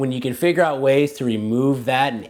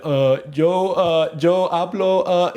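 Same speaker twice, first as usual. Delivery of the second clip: louder, higher, halting beginner stammer; bumbling language learner; 8 semitones higher, 6 dB louder, slower than usual. Acted. Student presenting themselves to the social world as an idiot, bumbling. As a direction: play an idiot fumbling through slow Spanish. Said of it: "as an idiot".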